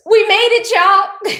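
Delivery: joyful shout. Real, not acted. Vlogger cheering the return of Eurovision, joyful shout. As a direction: shout it out in triumph. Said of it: "I am so happy".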